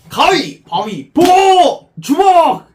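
loud, high excited call; shouting. Real, not acted. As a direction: shout out rock paper scissors. Rock paper scissors call.

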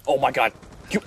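ordinary delivery

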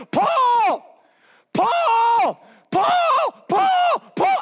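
high, loud drawn-out yell; yelling his name. Acted. Crazy lady following Paul and Silas, high yelling. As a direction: holler it like a crazy lady tailing them. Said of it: "she was yelling his name".

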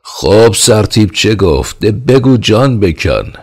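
slow, loud roar, pitched high; king roaring at the trembling operator. A narrator's reading. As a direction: bellow at the servant to spit it out. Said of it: "نعره کشید".

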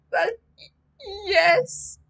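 high, cracking frightened voice; a scared boy's voice cracking. Acted. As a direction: let the voice break on the yes. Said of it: "My voice cracked".

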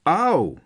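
high, loud "ow"; sharp acted cry of pain; pained yelp. A narrator's reading. Character cries out in pain.

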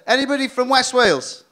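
rally the room; calling out. Real, not acted.

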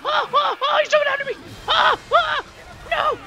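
high, loud yelps of panic; panicked screaming. Real, not acted. Player being jumped in a game, shrieking in panic. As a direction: scream in bursts as you're attacked.

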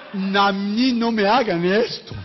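slow, drawn-out mock complaint; a playful, hammed-up don't do this to me; mock protest. Preacher teasing the pastor about the car doors, slow mock protest. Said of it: "como si le dijera clavillazo".

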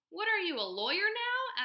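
high, loud exasperated mom; incredulous challenge. A narrator's reading. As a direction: throw the question back in disbelief. Mom challenges her bargaining son.

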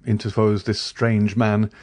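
ordinary delivery, nothing marked

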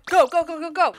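urgent urging. Real, not acted. Player rushing to party with a friend, urgent. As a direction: hurry them along insistently.

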